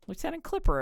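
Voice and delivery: high, bright name call; excited announcement. Real, not acted. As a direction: announce her like a star. Gushing announcement of NPC name.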